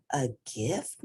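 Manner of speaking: soft, hushed wonder; whispered. A narrator's reading. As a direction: hush it in disbelief. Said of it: "whispered Murray".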